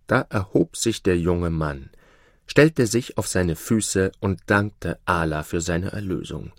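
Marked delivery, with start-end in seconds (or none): none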